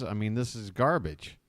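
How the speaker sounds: normal talking voice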